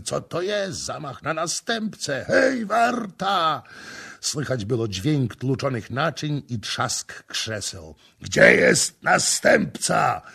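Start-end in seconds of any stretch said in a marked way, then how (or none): 0.0-3.6 guests crying out for the guard; rising alarm, last call high and slow. A narrator's reading. Startled men at the feast calling the guard. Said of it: "mężczyźni wołali". 8.2-10.2 slow, drawn-out roar. A narrator's reading. Stranger roaring for the prince in the dark hall. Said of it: "ryczał obcy człowiek".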